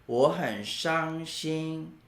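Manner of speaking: normal talking voice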